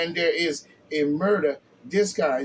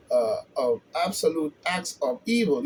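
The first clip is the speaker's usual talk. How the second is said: usual speaking voice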